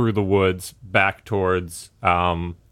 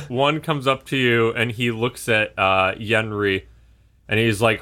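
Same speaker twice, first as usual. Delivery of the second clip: ordinary delivery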